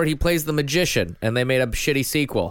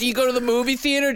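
normal talking voice

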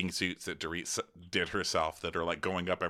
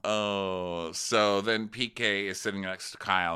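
ordinary talk

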